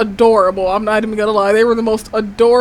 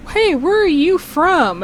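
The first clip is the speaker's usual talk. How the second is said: put-on curious old lady; 7 semitones higher, slower than usual. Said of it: "this old lady was like".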